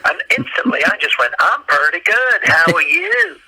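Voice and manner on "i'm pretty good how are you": high, slow Southern drawl; mimicked Southern accent. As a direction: parrot a Southern waitress's accent, pitched up. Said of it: "I love your accent".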